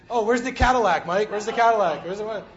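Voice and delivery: loud, mocking Cadillac brag; mock car-obsessed boast. Pastor mimicking friends' teasing, loud mock boast. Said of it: "you guys used to make fun of me when I did".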